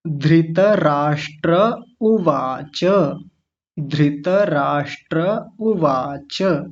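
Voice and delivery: धृतराष्ट्र उवाच recited very slowly, syllable by syllable; slow full demo. Shown as a demonstration. Teacher reading the full phrase for the class. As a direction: model the whole phrase slowly. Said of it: "ऐसा बोलेंगे".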